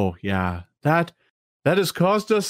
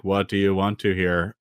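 zoned out; 10 semitones lower than usual. Retold or imitated. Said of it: "kind of zoned out".

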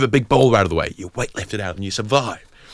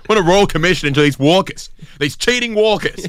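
mock outrage; 9 semitones higher, 3 dB louder than usual.

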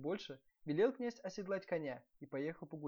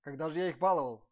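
prince's much louder protest; loud retort; 12 dB louder than usual. A narrator's reading. Prince answering his wife's reproach, loud protest. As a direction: throw the question back loudly. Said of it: "обрадовался князь".

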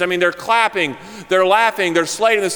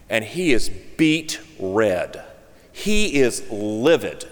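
very slow, low, weighted words; ominous emphasis; 7 semitones lower, much slower than usual. A narrator's reading. Preacher describing the livid minister, ominous slow emphasis. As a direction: drag it out ominously, word by word.

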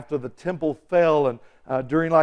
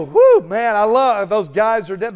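loud whoop into raised celebratory voice; gleeful onlooker; 5 semitones higher, 7 dB louder than usual. Acted. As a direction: cheer like a delighted spectator. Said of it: "And you go".

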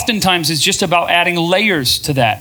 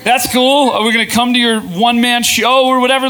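dismissive friend; 6 semitones higher than usual. Retold or imitated. Friends doubting her new path, patronizing and dismissive. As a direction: brush off the idea with fake approval. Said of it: "they just go".

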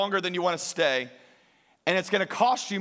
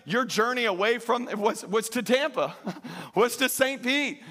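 normal talking voice

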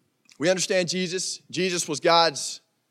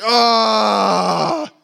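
long, loud villain scream; drawn-out hammed-up yell; acted scream; 3 semitones higher, 6 dB louder than usual. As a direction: scream like a movie villain seized by power. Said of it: "he screams".

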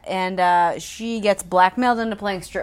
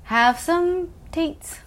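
slow, higher cooing; slow baby talk; 8 semitones higher, much slower than usual. Pretend. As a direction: coax it like feeding a toddler. Voicing the baby-talk scene.

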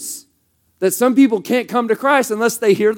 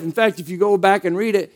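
ordinary talk

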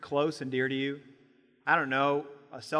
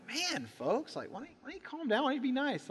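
mock exasperation; 6 semitones higher, 7 dB quieter than usual. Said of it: "the comedian's like".

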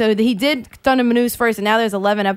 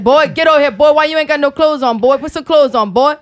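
put-on louder scolding Cajun accent; Cajun grandpa accent; 4 semitones higher, 4 dB louder than usual. Imitating her Cajun grandpa to friends, Cajun accent. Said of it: "papa said".

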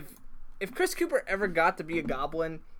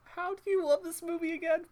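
incredulous; 11 semitones higher than usual. Real, not acted. Teasing disbelief at his friend.

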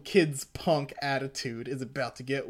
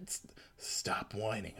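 whisper; 5 semitones lower, 9 dB quieter than usual. Acted. Whispered comic line.

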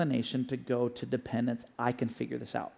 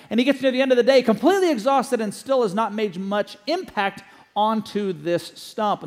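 lively preaching story; 8 semitones higher, 8 dB louder than usual. Acted. Telling story with preaching force.

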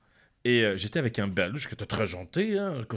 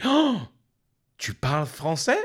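high, loud gasp of mock shock; hammed-up surprised question; retold astonishment; 4 semitones higher, 5 dB louder, slower than usual. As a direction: act out being stunned, starting high. Retells astonishment at actor speaking French.

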